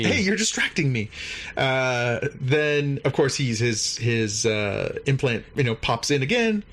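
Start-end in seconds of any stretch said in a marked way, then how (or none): 0.0-1.1 repeated quote, pitched higher; higher guard voice. Retold or imitated. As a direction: say it again in a higher, odder voice.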